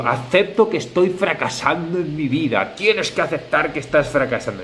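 acted self-talk. Example of false acceptance, acted self-judgement. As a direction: act out someone insisting on their own failure. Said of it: "es como si digo".

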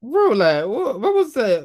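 high, loud disbelief; shocked outcry. Real, not acted. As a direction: shout in shock.

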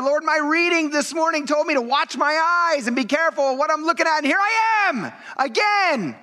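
high, pleading self-reproach building to a cry; anguished sorrow. Acted. Repentant sinner praying to God, anguished pleading. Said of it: "You feel sorrow".

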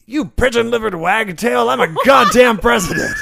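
high, loud outraged bellow; furious shout. Acted. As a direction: roar your outrage at her. President character furious at attacker.

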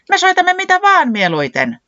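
very high shouted answer; excited exclamation. A narrator's reading. Puuhis answers what they prefer playing, exclaiming. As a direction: cry it out eagerly and loudly. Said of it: "huudahti Puuhis".

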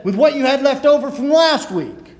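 high, loud, gleeful jab; punchline jab. Retold or imitated. Old man delivering the joke's punchline, loud and gleeful. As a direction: land the punchline with relish.

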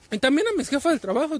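normal talking voice

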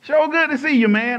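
high, loud friendly greeting; lightly voiced hearty stranger; a hint of a cheerful stranger greeting him in a store; stranger's greeting. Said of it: "That's how he was talking".

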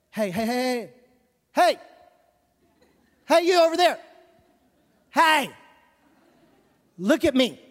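loud sing-song parent calls; hey hey, look at me, shouted up high; calling a child. Retold or imitated. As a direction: call out to get a toddler's attention.

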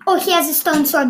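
normal talking voice

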